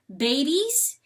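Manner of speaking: a jolt of disbelief; stunned. A narrator's reading. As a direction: freeze in shock at the news. Coyote startled by news.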